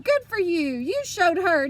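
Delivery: put-on grandma cheering the girl across the pool; yelling. A narrator's reading. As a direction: cheer her on from the poolside. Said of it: "she yelled".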